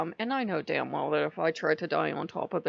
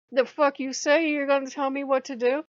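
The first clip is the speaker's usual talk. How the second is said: higher, louder, affronted "the fuck you say"; mock indignant snap; 7 semitones higher, 3 dB louder than usual. A woman daring a bossy man to give orders, mock indignant. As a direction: bristle at being told what to do. Women snapping back at bossy men.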